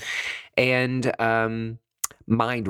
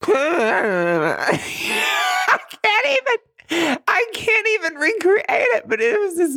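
high, louder words breaking into helpless laughter; laughing hard; 4 dB louder, slower than usual. Real, not acted. Retelling the meditation noise to viewers, laughing helplessly. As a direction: laugh through it, can't get the words out. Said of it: "I start busting out laughing".

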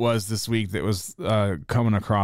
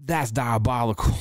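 imitating [name]; 5 semitones higher than usual. Podcaster parodying [name] bragging about wealth, hammed-up. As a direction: brag it up like a self-impressed mogul. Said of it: "He's like".

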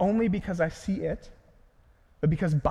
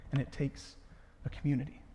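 normal talking voice

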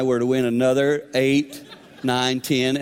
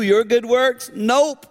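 higher and louder, punchy; emphatic preaching; 11 semitones higher, 5 dB louder, much slower than usual. Real, not acted. Preacher challenging the crowd, higher and louder. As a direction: fire the question and slam the answer.

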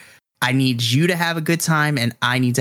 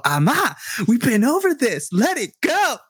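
put-on much higher whiny teen voice; mom imitating her son; 11 semitones higher than usual. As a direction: whine like an embarrassed kid. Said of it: "you just hear him go".

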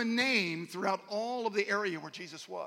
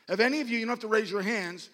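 ordinary talk, nothing marked

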